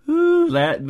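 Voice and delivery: high, loud gloating ooh. Real, not acted. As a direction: relish the hit. Gloating reaction.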